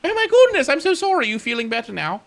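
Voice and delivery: very high, loud apologetic fluster; pompous admiral suddenly full of sympathy; fussy admiral. Acted. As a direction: gush like a flustered old admiral.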